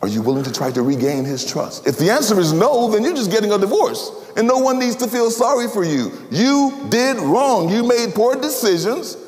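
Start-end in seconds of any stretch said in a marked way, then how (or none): 1.9-9.2 higher, ringing rebuke; forceful preaching. Acted. Preacher confronting sin, with high force. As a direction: hammer the hard truth home.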